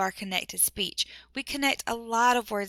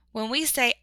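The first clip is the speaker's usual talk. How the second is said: usual speaking voice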